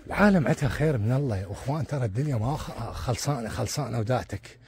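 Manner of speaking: usual speaking voice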